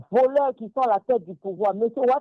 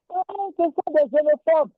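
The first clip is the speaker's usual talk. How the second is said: higher-pitched mimicking jeer; mock accusation; 6 semitones higher than usual. Mimicking those who silence Ivorians who want to speak, mock accusation. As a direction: imitate the accusers in a raised pitch.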